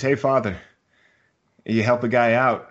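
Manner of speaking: calling up for help. Acted. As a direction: shout up to the passing priest from the hole. Man in hole calls to priest.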